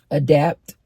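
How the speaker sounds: usual speaking voice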